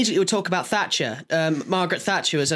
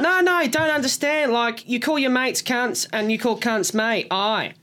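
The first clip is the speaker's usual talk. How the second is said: higher, earnest tipsy Aussie explaining; drunk Australian accent; 4 semitones higher than usual. Retold or imitated. Drunk Darwin workmate explaining slang, imitated Australian accent. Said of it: "she was pissed".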